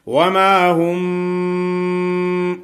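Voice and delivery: وما هم with a nasal م hidden into the lips, drawn out; lip ikhfa. Shown as a demonstration. Teacher shows ikhfa shafawi on وما هم, slow and sung. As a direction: hold the م of هم nasal and hum it into the lips. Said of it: "ایسا پڑھیں گے".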